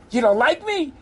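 high, indignant question; acted wounded indignation; aggrieved protest. Moses' complaint repeated.

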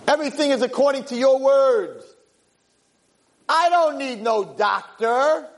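high, pleading, fervent voice; heartfelt cry to God. Acted. Father speaking to Hashem after the blessing, high fervent plea. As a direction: pour it out to God like a broken father.

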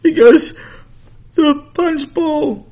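put-on high, loud, slow wail of a little girl; crying child. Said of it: "why are you crying?".

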